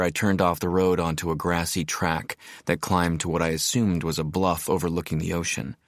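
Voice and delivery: usual speaking voice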